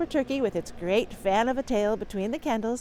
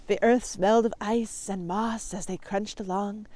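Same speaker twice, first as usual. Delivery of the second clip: plain reading voice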